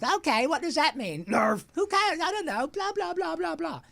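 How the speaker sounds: high, loud squeaky mouse voice; squeaky lab mouse. Retold or imitated. Actor reenacts being cast as a buck-toothed mouse, high and squeaky. Pinky casting scene.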